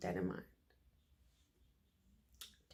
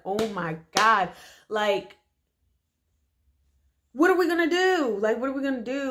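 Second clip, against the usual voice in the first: panicked; 2 semitones higher, 4 dB louder than usual. Retold or imitated. Sisters stranded, panicking about what to do next. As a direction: blurt it out in a panic.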